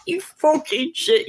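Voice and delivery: high burst of laughter into the swear; cackling while cursing at him; laughing. Real, not acted. Mocking the dodgy man, laughing. Laughing at messaging man's antics.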